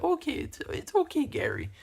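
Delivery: lightly mimicked reassurance in an altered voice; Titus impression. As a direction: mimic the man consoling a fake crier. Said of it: "He talked like that".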